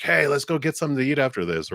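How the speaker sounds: fast and excited, like a new friend; eager invite. Retold or imitated. Friend's eager invitation, acted quote.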